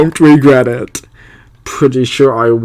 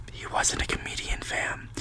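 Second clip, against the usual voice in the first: whispered aside to the audience; hushed whisper; 22 dB quieter than usual. Real, not acted. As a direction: breathe it as a secret aside. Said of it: "aside to viewers".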